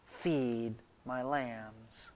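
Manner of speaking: very slow, low and soft; calm quiet command. Acted. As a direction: lay down each word gently. Acted Jesus answering Peter calmly.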